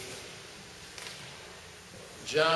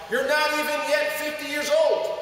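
much higher, loud shouting accusation; shouted challenge; 11 semitones higher, 3 dB louder than usual. Acted. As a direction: hurl it at Jesus.

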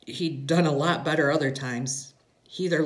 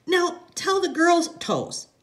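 put-on high, slow child's request; small girl coaxing her daddy; little girl; 4 dB louder, slower than usual. A narrator's reading. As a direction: ask high and drawn out like a small daughter. Little girl voiced in story.

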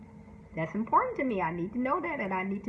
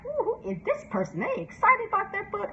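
high, delighted ooh; delighted reaction, higher; delighted curiosity; 9 semitones higher than usual. Retold or imitated. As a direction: sound thrilled by the eager author. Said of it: "And I'm like".

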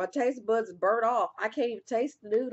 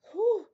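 high whew; 12 semitones higher, 6 dB louder than usual. Real, not acted. Exhale after spicy challenge.

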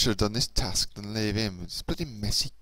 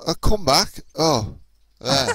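louder, higher croaky push to get the voice back; voice coming back; 6 semitones higher, 9 dB louder than usual. Real, not acted. Gamer coaxing his lost voice back, strained and loud. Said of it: "My voice is gone".

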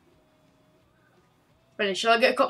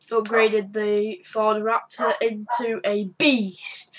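ordinary talk, nothing marked

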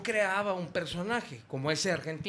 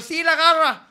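proud camp reply; 11 semitones higher, 9 dB louder, slower than usual. Pretend. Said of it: "Y yo".

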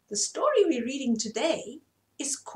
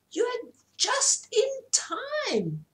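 very high, slow, crying peacock voice; a grand peacock announcing, high and slow; proud peacock; 13 semitones higher, slower than usual. Acted. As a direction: stretch it out high like a showy peacock calling.

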